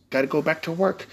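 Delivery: ordinary talk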